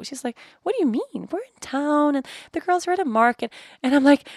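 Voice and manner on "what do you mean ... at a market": high-pitched, breezy retold reply; puzzled mom. Mom explains they are in town, puzzled. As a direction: act out a mildly baffled mother.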